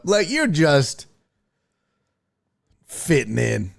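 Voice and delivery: usual speaking voice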